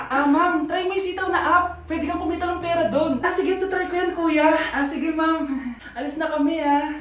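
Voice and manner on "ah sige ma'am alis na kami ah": ordinary talk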